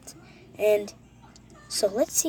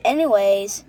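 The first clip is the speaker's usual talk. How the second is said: loud anyways; 9 dB louder, slower than usual. Real, not acted. Loud restart after a pause.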